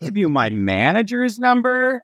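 put-on retelling my teen self. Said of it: "And I'm like".

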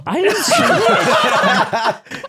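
high, drawn-out laughing protest. Real, not acted. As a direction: squeal it out through laughter. Denies a teasing claim while laughing.

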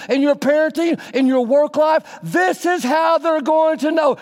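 high, loud preaching cry; preaching at full force. Acted. Preaching climax on discipleship.

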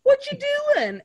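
high, loud sing-out greeting; mock sweet-talk. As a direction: imitate a falsely friendly come-on. Villain sweet-talks woman before ether.

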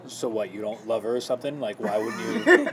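lightly voiced host, low and sceptical; a hint of a prodding interviewer, pitched low; Chris Harrison. Host questioning the suitor after the final rose, low mock challenge. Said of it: "Chris Harrison was like".

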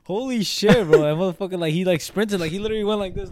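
breathless excitement; excited. Real, not acted. Excited retelling of dream.

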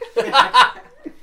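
risa fuerte y aguda; carcajada aguda. Real, not acted. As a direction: suelta la carcajada. Ríe del comentario del mesero. (carcajada aguda)

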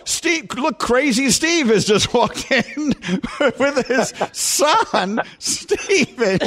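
high, slow, laughing through a mock crowd reaction; mock laughing onlooker. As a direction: laugh while imitating the people who'd mock him. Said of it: "They'd say".

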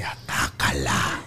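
low, slow, whispered devil voice; evil little angel. Acted. Bad angel urging Antonio to attack, sinister whisper. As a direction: tempt with a drawn-out unvoiced whisper. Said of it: "pero el malo te dice".

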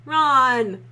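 sharp loud warning call; dangerous voice. Acted. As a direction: snap the names out loud. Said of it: "came Hermione's dangerous voice".

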